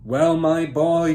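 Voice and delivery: big booming slow greeting to his son; loud voice. Acted. Father booming proudly at his son. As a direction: boom it out, pleased with himself. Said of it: "addressed his son in a loud voice".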